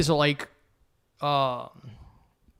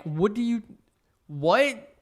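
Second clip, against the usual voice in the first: mock bafflement; 7 semitones higher, slower than usual. Baffled reply to angry Rage fans.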